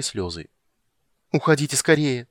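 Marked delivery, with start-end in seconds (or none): 1.3-2.3 desperate plea. A narrator's reading. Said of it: "в отчаянии пробормотала она".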